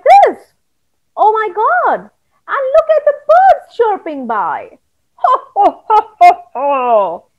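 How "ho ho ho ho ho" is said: jolly ho ho ho. Acted. Stick man's jolly laugh.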